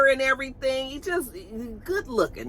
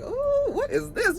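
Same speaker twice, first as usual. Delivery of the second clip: intrigued ooh. Retold or imitated. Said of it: "I was like".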